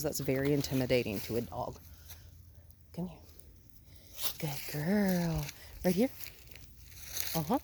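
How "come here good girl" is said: usual speaking voice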